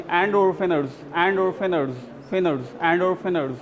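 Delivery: "and or thinners" with the r softened almost away; light r demo. Shown as a demonstration. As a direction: drop the r in "thinners" to a whisper of a sound. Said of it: "आर को हल्का कर देंगे". ("and or thinners" में आर हल्का)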